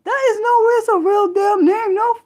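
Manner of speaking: very high squeal of disbelief; flabbergasted. Real, not acted. Streamer floored by a real town name, high squeal.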